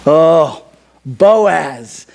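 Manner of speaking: low, drawn-out sigh over the name; mock swooning. Preacher introducing the hero of the story, mock swooning.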